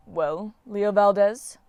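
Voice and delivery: slow drawn-out challenge; challenging. A narrator's reading. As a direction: press him, unhurried. Apollo challenges Leo.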